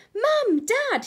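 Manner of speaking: high, loud call to his parents; excited cry. Acted. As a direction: shout for mum and dad, bursting with excitement. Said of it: "he cried".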